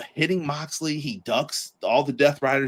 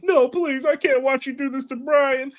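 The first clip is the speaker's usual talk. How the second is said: put-on pleading young punk; 11 semitones higher than usual. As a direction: beg like a scared kid watching his friend. [name] voiced begging as punk.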